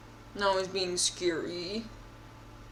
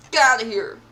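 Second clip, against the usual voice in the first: loud, sharp get out; angry loud dismissal; loud shout; 2 semitones higher, 9 dB louder than usual. Real, not acted. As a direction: yell him away. Fending off attacker.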